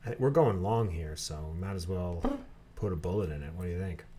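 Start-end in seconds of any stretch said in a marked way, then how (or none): none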